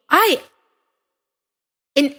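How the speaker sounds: usual speaking voice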